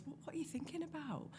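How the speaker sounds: soft unvoiced whisper; whispered question. Girlfriend whispering to her on the couch, hushed. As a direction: breathe the question out gently. Girlfriend asking quietly in bed.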